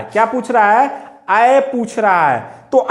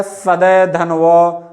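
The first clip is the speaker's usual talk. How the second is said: slow wrong split; 4 semitones lower, much slower than usual. Shown as a demonstration. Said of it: "ये भी गलत है".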